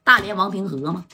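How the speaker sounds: ordinary delivery, nothing marked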